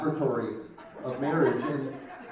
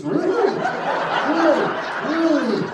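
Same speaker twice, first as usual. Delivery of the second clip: mock interest; 10 semitones higher, 7 dB louder than usual.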